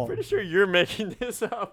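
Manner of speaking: laughing mid-sentence. Real, not acted. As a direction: break into a laugh while accusing. Teasing disbelief at made-up quote.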